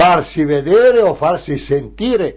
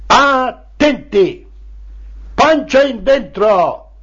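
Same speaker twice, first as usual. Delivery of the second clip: high, drawn-out marching orders called out; drill commands; 7 semitones higher, slower than usual. Acted. Secretary drilling children like little soldiers, drill commands. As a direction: call military orders, stretching each word.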